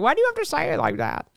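high, fast mock whine; put-on needling voice; mocking imitation. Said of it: "he was, like, making fun of me".

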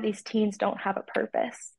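whispered, choked-up aside; emotional whisper. Real, not acted. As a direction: let it drop to a near-breathless whisper. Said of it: "it kinda makes me emotional".

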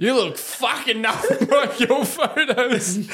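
laughing through it. Retold or imitated. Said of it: "Pico just comes out with".

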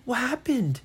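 high, loud startled reaction. Acted. Friends jolted awake by Brian's yell, startled.

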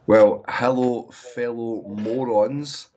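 rough, slow man flu croak; hoarse voice. Real, not acted. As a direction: speak through a sore throat. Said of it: "excuse the voice that's coming from me".

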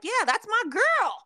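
high, loud, drawn-out cheer; playful high-pitched whoop of approval; approving cheer. Acted. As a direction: hype up your friend in a high voice. Acts out a delighted friend's response.

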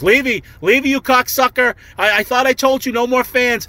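high-pitched mock yell at a friend; hammed-up high complaint; retold angry yell. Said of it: "yelling at each other".

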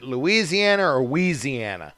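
full Louisiana, then slurred "Weeziana"; pronunciation comparison. Shown as a demonstration. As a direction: contrast "Louisiana" with local "Weeziana", first syllable dropped. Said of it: "now, is it".

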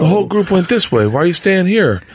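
put-on high, childlike calf calling its dad; baby buffalo voice. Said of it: "a little baby buffalo comes over".

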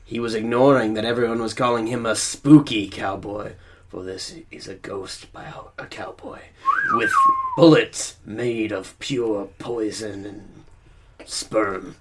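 game master narration. A narrator's reading. Narrator voice for the scene.

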